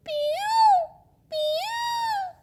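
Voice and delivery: high piercing "piyu" bird call; peacock cry imitation. Girl mimicking a peacock call.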